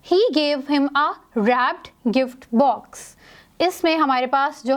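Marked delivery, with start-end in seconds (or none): 0.1-3.0 very slow dictation of the sentence; example read aloud. Shown as a demonstration. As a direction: spell it out slowly for the class. Reads example sentence slowly. (बहुत धीमे उदाहरण पढ़ना)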